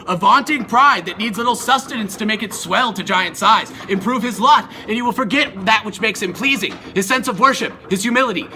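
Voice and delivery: ordinary delivery, nothing marked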